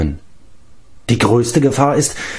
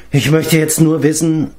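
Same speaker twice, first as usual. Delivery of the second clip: ordinary delivery, nothing marked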